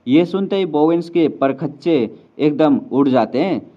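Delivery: ordinary delivery, nothing marked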